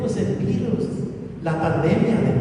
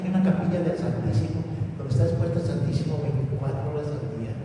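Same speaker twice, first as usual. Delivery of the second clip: ordinary talk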